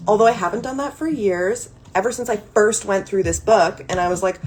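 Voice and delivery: normal talking voice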